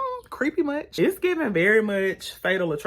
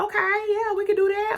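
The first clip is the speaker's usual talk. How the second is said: eager agreement; 14 semitones higher than usual. Retold or imitated. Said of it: "and she like".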